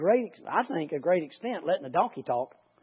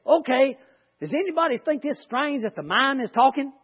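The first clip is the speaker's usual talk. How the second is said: higher, playful donkey voice asking the crowd; mock donkey voice; 7 semitones higher than usual. Teacher voicing the donkey to her class, higher and playful. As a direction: pitch it up as the talking donkey. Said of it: "And the donkey said".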